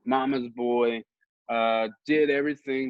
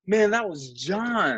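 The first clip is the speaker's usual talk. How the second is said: imitated protest; 9 semitones higher, slower than usual. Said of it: "And he's like".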